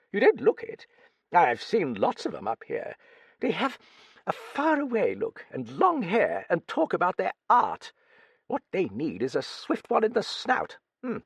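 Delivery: put-on high, crusty old British lady voice; old Duchess. A narrator's reading. Old Duchess quizzing the young writer, high crusty voice. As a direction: play a brusque, nosy old dowager.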